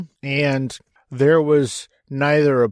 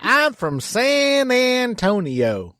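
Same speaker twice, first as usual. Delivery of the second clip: put-on slow, higher hillbilly accent on request; 9 semitones higher, much slower than usual. Answering where he grew up, on request: hillbilly accent. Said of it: "say it in a hillbilly accent".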